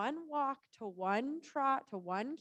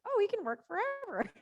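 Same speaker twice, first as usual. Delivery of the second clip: mock naive self; 8 semitones higher, 3 dB louder than usual. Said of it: "I'm like".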